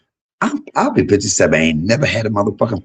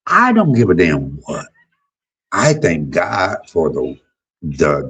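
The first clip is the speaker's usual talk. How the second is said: usual speaking voice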